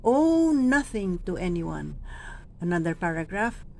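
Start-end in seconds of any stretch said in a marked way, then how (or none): 0.1-2.0 Owe lifted and drawn out; slow stress on owe. Shown as a demonstration. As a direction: lean on Owe. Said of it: "Dito sabi natin".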